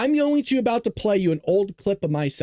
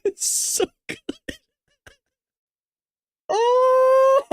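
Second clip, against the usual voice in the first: high squealing laughter; giggling delight, much higher; laughing hard; 3 dB louder, much slower than usual. Real, not acted. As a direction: crack up at the photo. Host cracking up at photo.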